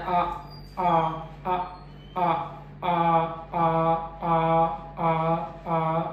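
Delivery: आ as an open, level "aa"; first-vowel demo. Shown as a demonstration. Teacher showing the first sound of the diphthong, open repeated आ. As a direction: repeat आ as separate pure vowels on one note. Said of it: "इसमें मेरा पहली sound है".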